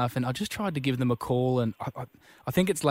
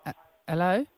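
put-on little boy; 3 semitones higher than usual. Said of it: "He's a four-year-old little guy".

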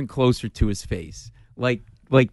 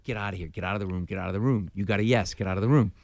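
quiet inner pep talk, far softer; muttering to himself; 6 semitones lower, 6 dB quieter, faster than usual. Retold or imitated. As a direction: urge yourself under your breath to leave. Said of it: "just saying to myself".

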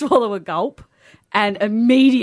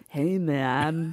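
stoned hippie; 4 semitones lower, 8 dB quieter than usual. Retold or imitated. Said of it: "now he talks like this".

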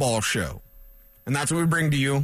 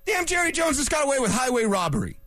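ordinary delivery

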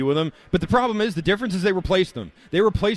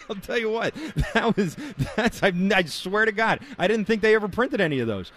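chuckling through the words, pitched up; laughing; 6 semitones higher than usual. Real, not acted. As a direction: laugh while you talk, amused. Amused by rare Gagne shirt.

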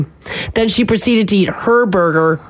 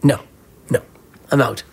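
firm mock refusal; 9 semitones lower than usual. Refusing imagined old macaroni.

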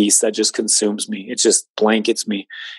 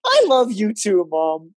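high, laughing ironic comeback; laughing reply; 11 semitones higher than usual. Retold or imitated. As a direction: toss it back with a laugh.

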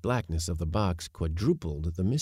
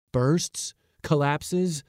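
higher, alarmed echoing questions; mild alarm; 7 semitones higher, 3 dB louder, much slower than usual. A narrator's reading. Nephew suspecting the box may explode, mildly alarmed. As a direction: cry each word back in mild alarm. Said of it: "cried I in alarm".

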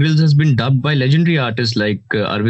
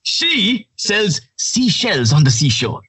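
much higher, very slow and drawn out; slow, high adventure narrator; Bear Grylls impression; 11 semitones higher, slower than usual. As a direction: stretch each word like a survival host. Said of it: "How would he deliver this?".

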